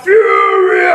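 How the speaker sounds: loud, lively exclaim; excited shout. Real, not acted. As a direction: shout it with glee. Excited storytelling.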